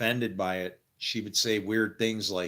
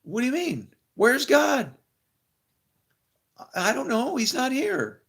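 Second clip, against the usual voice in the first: high mock-confused "Where's God?"; bewildered reply; 6 dB louder than usual. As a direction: play the clueless caller, pitched way up. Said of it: "And I'd be like".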